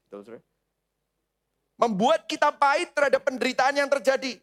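normal talking voice

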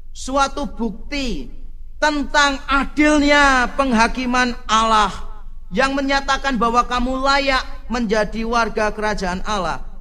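loud, slow, high-pitched declamation; preacher declaiming a Bible verse; emphatic scripture reading. Real, not acted. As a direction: proclaim the verse slowly and forcefully.

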